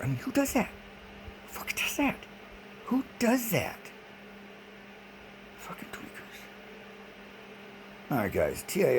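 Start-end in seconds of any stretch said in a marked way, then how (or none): 0.0-3.8 much higher, incredulous rant; who does that, in disbelief; exasperated. Real, not acted. Ranting to viewers about repeating friends, high exasperated disbelief. 5.6-6.4 much softer whispered insult; whispered curse. Real, not acted. Cursing the tweakers under his breath, whispered.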